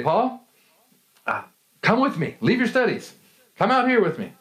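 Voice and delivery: a hint of the father calling the boy out of class; dad calling son. Father calling son away from homeschool, lightly voiced and eager.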